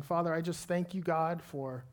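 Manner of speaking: soft, unhurried pastoral prayer over music; quiet earnest pulpit prayer; closing prayer. Acted. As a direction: lead the room gently into prayer.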